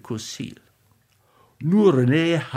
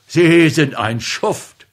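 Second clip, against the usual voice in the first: slow, much louder insult; furious shout; 3 semitones higher, 8 dB louder, slower than usual. A narrator's reading. Husband shouting at his rival, furious. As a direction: spit the accusation out. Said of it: "Der Mann meiner Mutter rief".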